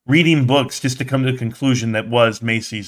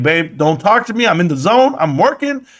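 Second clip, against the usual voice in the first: higher, urgent babe don't talk to me; hyped brush-off; 7 semitones higher than usual. Retold or imitated. Husband brushing off wife mid-work, hyped higher voice. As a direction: wave her off while in the zone. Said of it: "I'm like".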